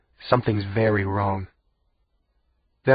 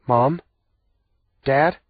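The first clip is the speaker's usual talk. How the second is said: questioning, higher, unsure call; tentative calling out; 5 semitones higher, 5 dB louder than usual. A narrator's reading. Sibling calling to parents in the dark, tentatively. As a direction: call out uncertainly, voice lifting at the end. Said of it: "I call out tentatively".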